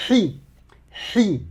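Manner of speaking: "hi" with a raspy, constricted h; Arabic ḥi sound. Shown as a demonstration. Teacher showing "hi" with a throaty h. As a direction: tighten the throat for the h of "hi". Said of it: "avec la voyelle I".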